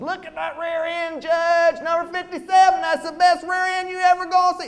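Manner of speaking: high, loud holler from the rail; yelling to the judge. Retold or imitated. Fan at the rail yelling to the horse show judge. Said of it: "you yell to the judge".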